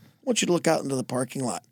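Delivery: low, soft and rushed; cool quiet order. Acted. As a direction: toss off a hushed command. Superman coolly ordering Aquaman.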